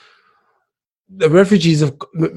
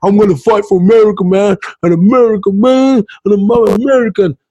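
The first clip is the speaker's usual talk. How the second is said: mock patriot; 5 semitones higher than usual. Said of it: "they'd be sitting there like".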